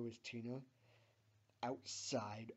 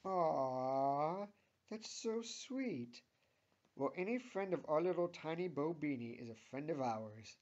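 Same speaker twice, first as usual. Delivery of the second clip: smiling; 4 semitones higher, 7 dB louder than usual. A narrator's reading. Linda welcomes her daughter's friend, smiling. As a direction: coo over how sweet it is, loudly. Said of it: "says Linda with a smile".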